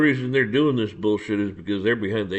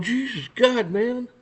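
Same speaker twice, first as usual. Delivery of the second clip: high-pitched, softer exasperated groaning words; mock dismay; 10 semitones higher, 3 dB quieter, slower than usual. Officials reacting to the mess, mock dismay. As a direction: imitate someone lightly despairing. Voicing dismayed people being looked at.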